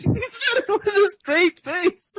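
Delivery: high, drawn-out words through laughter; real helpless laugh breaking up the words; laughing in disbelief. Real, not acted. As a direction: repeat it incredulously while cracking up. Echoes co-guest's joke while laughing.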